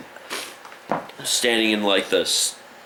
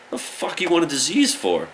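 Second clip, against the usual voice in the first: much higher mock outrage; the officer questions the kid: mock outraged, much higher; exasperated cop; 10 semitones higher than usual. Hammed-up exasperated policeman, high.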